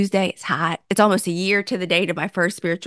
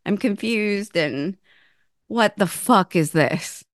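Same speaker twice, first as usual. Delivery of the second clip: distressed confusion; 4 semitones higher, slower than usual. Retold or imitated. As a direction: wail it out, then sink into confusion. Retold year of confusion.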